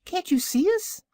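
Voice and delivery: very high little piglet question; teeny, high-pitched creature voice; tiny piglet voice. A narrator's reading. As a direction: squeak it like a tiny pig. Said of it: "spoke a tiny voice".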